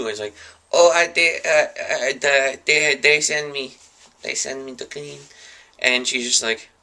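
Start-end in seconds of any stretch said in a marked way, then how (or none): 0.7-5.2 broken-English cleaner. Acted. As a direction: play a caught intruder faking a foreign cleaner. Dreamer keeps up cleaner act.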